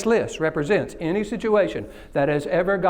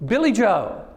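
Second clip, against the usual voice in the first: ordinary talk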